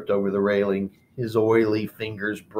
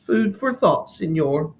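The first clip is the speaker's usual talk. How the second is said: icy; 6 semitones higher than usual. Acted. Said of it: "Martina said icily".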